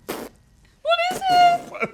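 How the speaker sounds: shocked disgust. Real, not acted. Tasting sour food.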